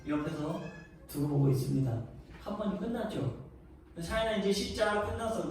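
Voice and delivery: usual speaking voice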